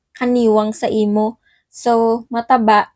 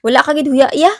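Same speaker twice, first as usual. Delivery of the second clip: louder, angry scolding; 4 semitones higher, 5 dB louder, faster than usual. Shown as a demonstration. Teaching how to scold someone shameless, louder angry. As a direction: snap it out like you're mad. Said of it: "Pag galit ka na".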